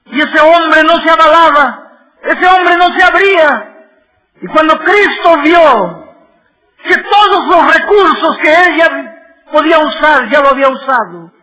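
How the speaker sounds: loud, high, ringing sermon delivery; impassioned pulpit cry; full-force preaching. Acted. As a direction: preach it out to the back pew.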